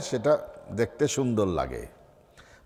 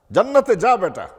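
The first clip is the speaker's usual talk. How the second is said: higher-pitched insistent order; mock-impatient command, pitched up; 7 semitones higher, 4 dB louder than usual. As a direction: send him off with a raised, firm voice. God orders the man back again.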